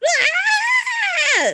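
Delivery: very high shrill far-off scream; distant scream. Retold or imitated. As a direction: horror movie echoing scream. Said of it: "the occasional".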